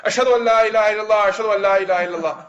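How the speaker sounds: fuller, projected recitation. Retold or imitated. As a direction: proclaim the testimony out loud.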